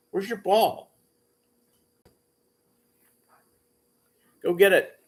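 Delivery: high, excited pet voice; talking to a dog. Real, not acted. Playing with dog.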